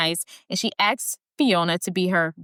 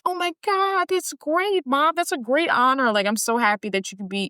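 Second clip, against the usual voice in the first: high-pitched mock delight, easing down; gushing son; 8 semitones higher than usual. Son thanking his mom for the honor, gushing. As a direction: gush as an overjoyed son, imitating.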